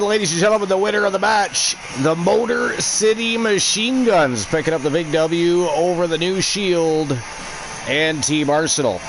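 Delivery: ring announcer. Acted. Streamer to chat, naming the tag team winners like an announcer.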